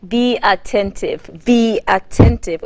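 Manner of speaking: ATTENTIVE drawn out slowly; emphatic urging. Real, not acted. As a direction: urge "be attentive" slowly, firmer on the repeat. Host urging viewers to listen.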